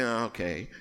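low, soft, offhand brush-off; cold reply. Retold or imitated. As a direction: freeze him out with a curt reply. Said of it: "acting all cold".

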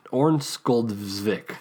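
Ornsköldsvik drawn out slowly after false starts; stumble, then slowly drag out Ornsköldsvik; struggling pronunciation. Shown as a demonstration. Host fighting the Swedish name again, slow and halting. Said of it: "Orn-, Orn".

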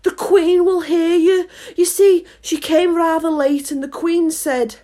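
high, anxious rabbit voice; nervous rabbit, no true whisper; frightened warning. A narrator's reading. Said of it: "whispered in a frightened tone".

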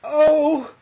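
high, drawn-out "oh" of delight; mock-swooning high coo; imitated fond gasp. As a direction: mimic your wife melting at a beloved character. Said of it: "she went full, like".

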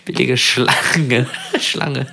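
lachend, lauter erzählt. Real, not acted. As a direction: prustet beim Erzählen los. Erzählt lachend vom Albtraum. (lachend erzählt)

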